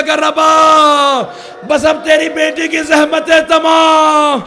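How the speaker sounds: loud sung majlis lament; preaching at full force. Acted. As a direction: cry the climax out to the hall. Climax of lament.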